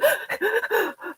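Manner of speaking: a sharp, high gasp for air; imitated panicked gasp. Imitating an upset person's sharp gasp. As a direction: gasp like someone losing it. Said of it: "when you hear the".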